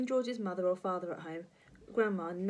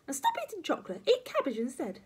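high-pitched granny orders; nagging old-lady voice; bossy grandma; 8 semitones higher, 4 dB louder, faster than usual. Acted. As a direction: lay down the rules crossly.

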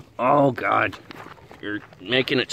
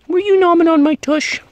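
squeaky pet talk; 7 dB louder than usual. Real, not acted. Asking the puppy who nipped, loud squeaky pet voice.

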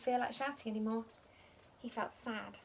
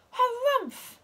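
much higher, loud squawking harumph; furious harumph; 14 semitones higher, 10 dB louder than usual. A narrator's reading. Pom Pom losing it over his hair, shrieking harumph. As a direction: explode with grumpiness. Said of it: "said Pom Pom".

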